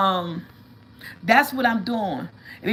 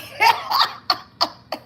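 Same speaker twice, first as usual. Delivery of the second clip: laugh; 5 dB louder than usual. Real, not acted. Laughing mid-story.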